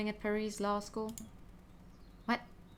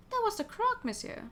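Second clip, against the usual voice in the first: much higher, sing-song repeat of "That was the Croc"; mocking echo; 11 semitones higher than usual. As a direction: parrot his words back in a high mock voice. Echoing the line back.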